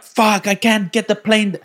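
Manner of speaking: high, slow strained mock-Russian cry; put-on Russian panic; panicking Russian pilot. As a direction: act the pilot losing control, pitched high. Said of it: "It's meant to be Russian".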